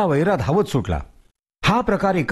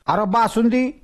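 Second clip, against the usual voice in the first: higher, coaxing village elder; coaxing Tatya; 8 semitones higher than usual. Acted. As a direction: sweet-talk the stubborn ghost. Tatya offering food.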